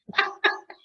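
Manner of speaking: short high-pitched laugh. Real, not acted. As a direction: laugh briefly at your own joke. Joking about screen share trouble.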